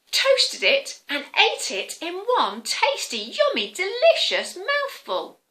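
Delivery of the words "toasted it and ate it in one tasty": ordinary delivery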